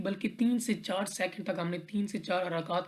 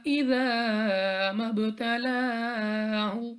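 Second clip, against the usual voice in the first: Quran recitation; 3 semitones higher, much slower than usual. Shown as a demonstration. Tajweed teacher recites the verse for students, slow melodic chant. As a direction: stretch every vowel in a long, sung recitation.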